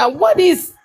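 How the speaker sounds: high-pitched startled question; astonished reaction. Retold or imitated. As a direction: blurt it out in acted amazement. Retells astonished reaction to returning voice.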